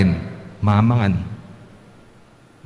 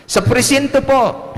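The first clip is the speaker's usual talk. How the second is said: much higher, slow little pupil voice; child's answer; 12 semitones higher, slower than usual. Pretend. Pupil answering the teacher, much higher kid voice. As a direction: chirp it like a small child.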